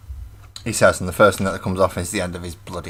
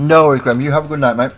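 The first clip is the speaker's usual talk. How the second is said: calling out; 6 semitones higher, 9 dB louder, much faster than usual. Real, not acted. Calling goodbye to a friend.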